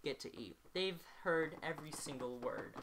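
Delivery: usual speaking voice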